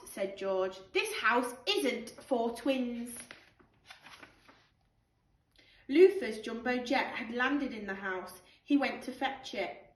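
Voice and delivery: put-on lively storybook read-aloud for children; animated picture-book narration with character voices; bedtime story reading. Teacher reads bedtime story aloud.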